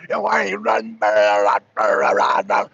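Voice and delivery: put-on low, garbled mumble of a toothless old man; toothless old-timer impression. Streamer mocking Pops who has no teeth, garbled low voice. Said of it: "can't understand what the hell he be saying".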